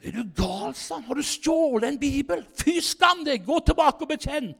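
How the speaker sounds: retold shocked friend scolding him; scandalised scolding. Kåre scolds him for stealing Bible.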